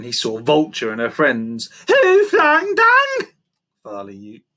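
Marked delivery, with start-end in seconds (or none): 1.9-3.3 very high, loud, slow monkey yell; accusing yell. A narrator's reading. Monkey confronting vulture and her friends, accusing yell. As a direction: hurl the accusation in a piercingly raised voice. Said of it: "yelled accusingly".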